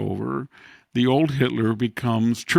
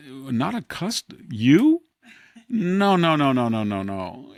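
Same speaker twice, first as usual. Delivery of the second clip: 1 incredulous mock disbelief; 3 semitones higher, 4 dB louder, slower than usual. Real, not acted. Mocking Al Gore's claim.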